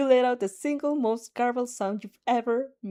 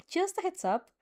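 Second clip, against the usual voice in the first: sly; 8 semitones higher, 3 dB quieter than usual. Acted. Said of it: "Mina slyly says".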